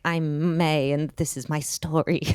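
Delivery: storybook self-narration, lightly voiced; dramatic narrator voice. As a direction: announce it like a movie narrator. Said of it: "I was like".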